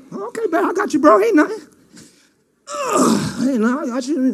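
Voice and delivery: soothing sister. Acted. Tamar soothing her sick brother.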